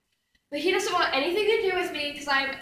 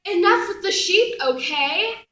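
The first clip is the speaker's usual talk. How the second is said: higher, louder fed-up retort; exasperated; 4 semitones higher, 5 dB louder, slower than usual. Acted. Goddess telling her priest to stop with sheep, exasperated. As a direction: put your foot down, exasperated.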